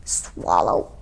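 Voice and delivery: low whispered gulp; swallow sound. Acted. Mother bear gulping down berries, whispered swallow. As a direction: swallow audibly.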